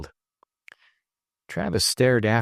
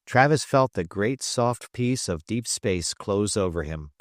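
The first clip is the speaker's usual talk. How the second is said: ordinary delivery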